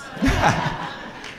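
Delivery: soft breathy chuckle; laugh. Real, not acted. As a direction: laugh softly at the interjection. Laughing after a joking interjection.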